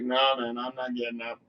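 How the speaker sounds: low, soft grumbling refusal; sulky refusal. Retold or imitated. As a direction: mumble it from your seat, not budging. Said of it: "I'm sitting there like this".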